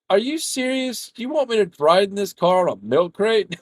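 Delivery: high, squeaky mock disbelief; incredulous disbelief. To his friend about riding on a milk crate, high incredulous. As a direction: pitch up in outraged disbelief.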